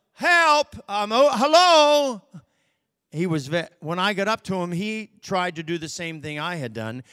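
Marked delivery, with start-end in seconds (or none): 0.1-2.2 high, loud, frightened calling; panicked lost man calling; shrill scared cry. Retold or imitated. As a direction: call out scared to death. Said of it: "his voice changed from that shrill, scared".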